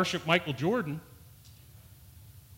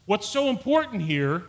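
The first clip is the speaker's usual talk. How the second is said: normal talking voice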